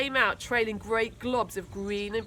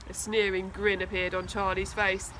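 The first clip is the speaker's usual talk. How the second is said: ordinary delivery, nothing marked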